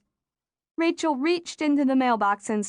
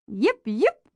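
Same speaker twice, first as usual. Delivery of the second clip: dog yapping. A narrator's reading. Dog yaps running away.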